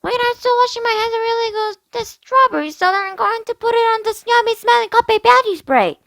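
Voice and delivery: little sister chattering from the bathroom; little girl voice. Acted. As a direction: play a carefree little girl.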